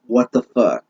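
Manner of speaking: normal talking voice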